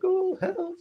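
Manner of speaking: hammed-up drowning man calling out. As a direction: squeak it like a man going under. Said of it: "they're like".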